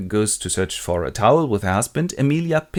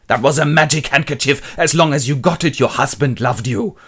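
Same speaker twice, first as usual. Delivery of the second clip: loud, insistent mock scolding; 4 semitones higher, 5 dB louder than usual. Husband scolds wife over lost handkerchief, acted anger. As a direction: press the point crossly.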